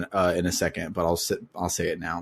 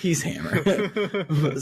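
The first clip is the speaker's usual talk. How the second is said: slow, laughing repeat; 7 semitones higher, much slower than usual. Real, not acted. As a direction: savor it through laughter. Laughing repeat of funny name.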